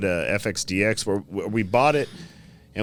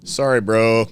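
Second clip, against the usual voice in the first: louder, hammed-up apology; mock sheepish apology; 3 semitones higher, 7 dB louder, slower than usual. Customer apologizing to shop owner, hammed-up sheepish. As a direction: play the caught-out customer apologizing. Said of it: "He's like".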